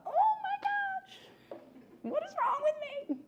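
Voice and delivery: very high, thin tearful squeak fading to whisper; mock wailing. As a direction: whimper at yourself in exaggerated shame. Mock self-reproach after the mistake.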